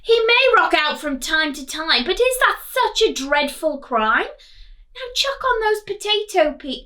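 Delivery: stern knight. Acted. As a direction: lecture them firmly for Dave's sake. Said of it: "a furious knight".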